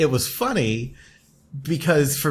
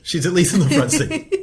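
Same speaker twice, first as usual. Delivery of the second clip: laughing through the words, a bit higher and louder; laughing repeat; 5 semitones higher, 4 dB louder than usual. Real, not acted. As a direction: echo her line while laughing. Echoing partner's joke, laughing.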